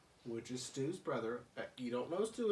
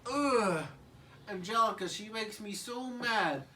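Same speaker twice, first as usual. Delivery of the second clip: put-on higher, louder fuming toddler; angry baby Tommy; 8 semitones higher, 4 dB louder than usual. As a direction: grumble like a mad little kid. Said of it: "Tommy's like".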